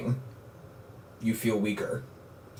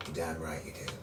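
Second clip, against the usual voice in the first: low gruff reply; 6 semitones lower, 14 dB quieter than usual. Acted. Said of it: "He says".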